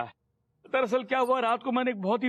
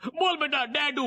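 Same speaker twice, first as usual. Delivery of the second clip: normal talking voice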